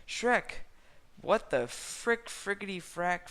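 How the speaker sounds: high, loud shocked cry; hammed-up gasp of outrage. A narrator's reading. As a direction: catch them in the act. Fiona catching them.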